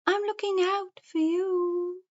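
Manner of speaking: high, hooting sing-song owl. A narrator's reading. Wise owl warns the forest creatures, high hooting voice. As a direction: say it as a long high hoot. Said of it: "He blinked his big round eyes and said".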